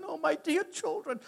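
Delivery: a hammed-up sob of joy; mock crying mother. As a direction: play the weeping mother, high-pitched. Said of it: "She's crying".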